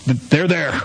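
halting, awkward mock consolation; uncomfortable comforting. Boss faced with a crying man, awkward and halting. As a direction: stammer out an uneasy attempt to comfort. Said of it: "he started to get uncomfortable and went".

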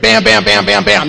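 loud, hammered beats, each one a fist; punchy bam bam. Retold or imitated. Bragging member firing off his AA resume, loud punchy beats. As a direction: rattle them off like a machine gun.

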